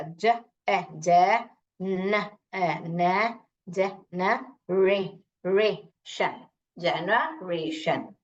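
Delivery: ज, न, रे, शन slowly, one piece at a time; sounding out. Shown as a demonstration. Teacher reads a big word by sounds, segmented जनरेशन. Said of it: "ऐसे पढ़ते हैं ना हम लोग".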